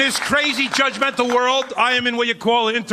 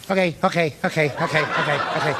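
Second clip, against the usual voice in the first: lower, rapid Pesci-style okays; Joe Pesci voice; 6 semitones lower than usual. Retold or imitated. Comic imitating his tiny angry brother-in-law, Joe Pesci style. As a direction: fire them off like a short angry guy. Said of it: "I hear this".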